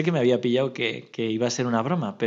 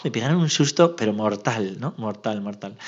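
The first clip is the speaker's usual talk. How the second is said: ordinary talk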